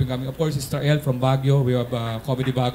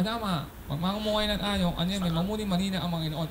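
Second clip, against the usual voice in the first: nasal-voiced angel; 5 semitones higher, 5 dB quieter than usual. Angel speaks as nasal-voiced messenger.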